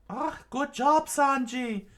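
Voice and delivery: very high, loud cheer; screamed out. Acted. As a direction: shriek praise. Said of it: "screamed out".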